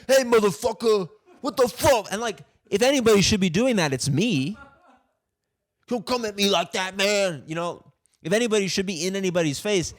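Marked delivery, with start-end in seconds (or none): 0.1-2.1 imitating a hothead, much higher and louder; hammed-up tough-guy challenge; mock aggressive guy. Guy confronting him, quoted. 5.9-7.4 much higher, imitating a hothead; hint of a guy squaring up; mock aggressive guy. Guy confronting him, quoted.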